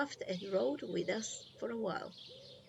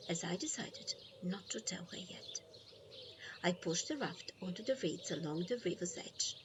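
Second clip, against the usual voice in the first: plain reading voice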